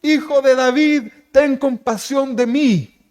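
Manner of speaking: high, drawn-out cry for mercy; desperate distant shout. Acted. Blind Bartimeo calls to Jesús far off, desperate shout. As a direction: call out loud to someone far away, stretching each word. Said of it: "Y empieza a gritar".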